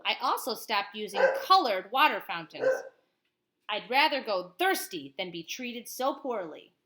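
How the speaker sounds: lively read-aloud of a picture book; reading to a child. Acted. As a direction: tell it like a bedtime story to a child. Parent reads picture book aloud.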